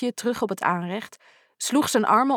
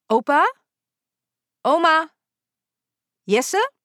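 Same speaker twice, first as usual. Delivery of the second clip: raised voice calling into the house, rising on each name; calling each name louder into the silent house; 8 semitones higher, 5 dB louder, slower than usual. Acted. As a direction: call out to someone in another room.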